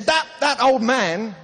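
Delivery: high, loud indignant complaint; acted outraged stammer; disrespectful outrage. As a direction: drag it out scornfully, imitating an angry passenger. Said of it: "which was very disrespectful".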